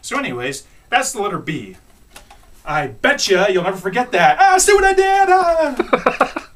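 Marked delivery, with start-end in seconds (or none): 4.4-6.5 high, loud gloating squeal; gleeful. Real, not acted. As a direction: crow over the joke. Gloating over own joke.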